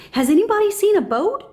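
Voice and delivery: lightly voiced anxious father fish; a hint of worried calling out; Marlin asking around. A narrator's reading. Marlin asking any fish who would listen, lightly voiced. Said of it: "he asked any fish".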